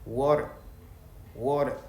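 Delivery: lightly American water; American accent. Shown as a demonstration. A teacher giving an American water again. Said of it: "lui va te dire".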